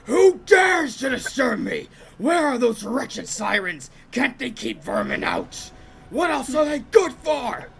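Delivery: loud, high booming god voice; booming ancient god. Acted. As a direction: thunder at the intruders from above. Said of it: "how intense the voice is".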